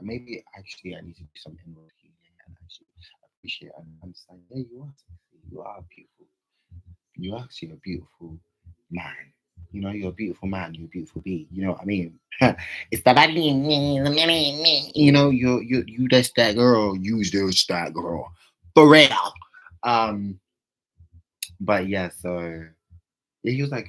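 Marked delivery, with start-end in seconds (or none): 0.0-9.1 fast, much softer murmur to herself; rushed quiet aside. Real, not acted. Talking herself into self-love, rushed and soft. 12.9-19.3 louder, higher hype-woman voice; big up yourself, full volume; loud self-hype. Real, not acted. Hyping herself up to her viewers, loud and high.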